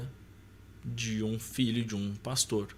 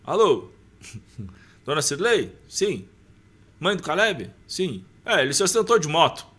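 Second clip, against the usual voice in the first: loud, high caller's voice on the phone; retold phone call; 6 semitones higher, 7 dB louder than usual. (ligação retomada, alto e agudo)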